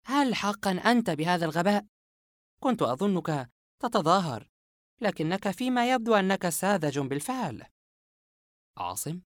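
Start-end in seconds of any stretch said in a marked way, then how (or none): 0.1-7.6 mild irritated scorn; lightly fed-up, talking down; exasperated. Acted. Yumna scolding naive Asem, a touch exasperated. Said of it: "يمنى بضجر".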